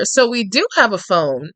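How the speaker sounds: usual speaking voice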